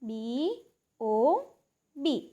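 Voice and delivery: બી, ઓ, બી as separate letter names, paused between; letter-by-letter spelling. Shown as a demonstration. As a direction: spell out બી... ઓ... બી for pupils. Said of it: "બીજી વખત".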